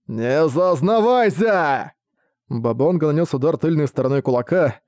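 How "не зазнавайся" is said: медленно, угрожающе, нарастая; чудовище обещает раздавить; злобный монстр. Acted. Монстр огрызается на Рэя. (угрожающий голос монстра)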